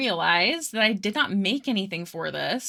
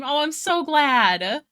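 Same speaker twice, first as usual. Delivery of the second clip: excited; 5 semitones higher, 4 dB louder, slower than usual. Real, not acted. Happy viewer came.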